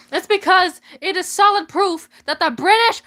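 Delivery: rising to a shout; building rant. Acted. Ranting to the crowd, building to a shout.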